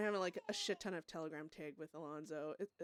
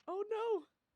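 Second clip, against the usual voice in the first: sudden high, loud gasp of dismay; shocked oh no; 8 dB louder than usual. Real, not acted. Reading a shocking telegram, a high, loud cry of dismay. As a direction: caught off guard by bad news.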